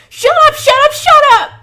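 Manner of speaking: extremely high, piercing screams; hysterical screaming. Acted. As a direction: scream over and over, losing control. Tyler keeps screaming, ears covered.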